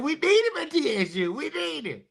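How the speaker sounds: high, whining desperate plea; joking wail that the school needs him; mock desperate plea. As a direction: beg like a heartbroken fan, hammed up. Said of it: "we're just joking".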